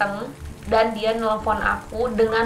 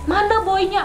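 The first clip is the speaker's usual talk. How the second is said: retold yell; 7 semitones higher than usual. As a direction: shout at the other girl to hand him over. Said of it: "Aku teriak di situ".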